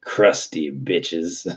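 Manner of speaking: very slow, stretched mocking line; drawn-out sneer. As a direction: drawl it like the skit. Echoing the skit line.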